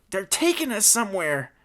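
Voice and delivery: high-pitched alarm; gasped. Acted. Petey bounced in the covered cage, gasping in alarm. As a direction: blurt it out in alarm. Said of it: "gasped Petey".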